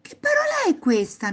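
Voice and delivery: put-on high-pitched puzzled child question; curious boy. A narrator's reading. Boy asking grandpa about a word, curious. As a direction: ask it high, full of curiosity. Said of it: "chiese Alvise incuriosito".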